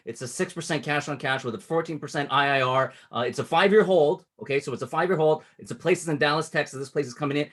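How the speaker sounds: quick, pressing sales call; fast urgent pitch. Shown as a demonstration. Coach demoing an urgent call to an investor, brisk. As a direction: rattle it off like the deal closes soon. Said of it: "that's intentionally at that cadence".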